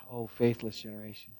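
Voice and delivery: low, very soft, sighing disappointment; burdened. Acted. Preacher voicing a disappointed Savior, softly burdened. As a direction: sigh it out under a heavy burden. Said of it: "he says it with a burden".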